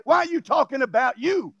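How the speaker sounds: ordinary talk, nothing marked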